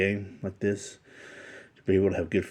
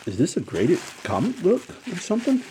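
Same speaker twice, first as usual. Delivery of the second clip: surprised; 11 semitones higher, 3 dB louder than usual. Real, not acted. Unboxing a surprise gift.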